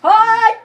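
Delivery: loud, high cry of defeat; exasperated yell. Pretend. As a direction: yell out at losing the battle. Losing badly in the game.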